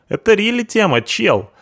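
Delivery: hoog, rauw punkersstemmetje, spottend; punk sidekick voice. Acted. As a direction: speel de brutale punker, veel hoger.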